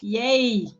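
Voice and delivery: loud happy yay; excited cheer. Real, not acted. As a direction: cheer with delight. Guest cheers at her introduction.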